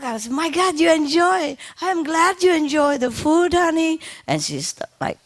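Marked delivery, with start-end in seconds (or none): 0.3-4.0 talking to the bird. Real, not acted.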